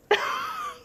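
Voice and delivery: high squealing giggle. A narrator's reading. Sister giggling at Lucas hanging upside down. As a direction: burst into helpless giggles. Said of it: "laughing so hard".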